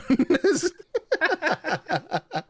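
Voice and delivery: loud, high-pitched burst of laughter; big high laugh. Real, not acted. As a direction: crack up helplessly at your own joke. Cracks up at own joke.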